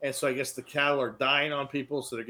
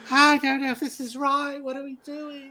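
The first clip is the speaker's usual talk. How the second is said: high frail British voice; 12 semitones higher than usual. Retold or imitated. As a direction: quaver it out like a frail old lady. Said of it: "She's got the high frail British voice".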